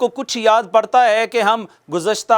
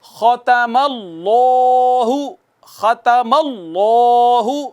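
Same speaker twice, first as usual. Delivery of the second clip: drawn-out tajweed recitation of ختم اللہ with heavy لام; slow recitation; much slower than usual. Shown as a demonstration. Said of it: "تو آئیے".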